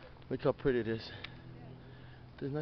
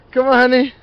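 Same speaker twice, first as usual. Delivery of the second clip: high, loud coaxing to a dog; high sing-song encouragement to a pet; 7 semitones higher, 10 dB louder than usual. Real, not acted.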